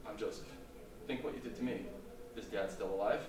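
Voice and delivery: low, soft, measured reproach with pauses; quiet rebuke. Shown as a demonstration. Joseph revealing himself to his brothers, quiet rebuke. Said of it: "I'm gonna change the cadence of my voice".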